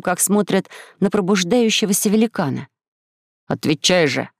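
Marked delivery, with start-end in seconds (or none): 3.5-4.3 low, insistent command; low-voiced pressing friend; demanding. A narrator's reading. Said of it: "потребовал Гумгам".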